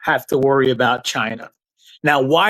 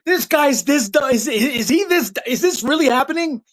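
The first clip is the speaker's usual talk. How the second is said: mock disbelief; 8 semitones higher than usual. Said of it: "They were like".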